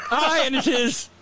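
very high put-on Scottish wife; Scottish accent. Shepherd's wife agreeing about the wee infant, mock Scottish accent. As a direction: squeal it in a playful Scottish accent. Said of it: "Or maybe she's Scottish".

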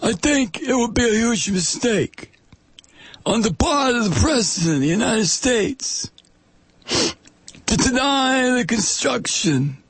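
high, strained Italian-American mobster voice; mafioso Italian accent. Acted. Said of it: "my whole family used to talk like that".

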